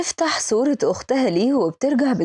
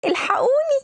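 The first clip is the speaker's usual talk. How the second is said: calling for help; 11 semitones higher than usual. Acted. Fleeing delivery girl cries out for rescue, panicked shout. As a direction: scream for help as you run from him.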